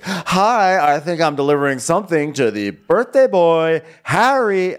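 high, loud showy delivery; ready-for-television. Acted. Boy toy greeting the birthday boy, showy TV voice. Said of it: "I'm ready for television".

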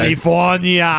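put-on high, loud Austrian-accented "California", drawn out; slow, hammed-up Austrian governor; governor impression. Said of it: "he couldn't even say the name".

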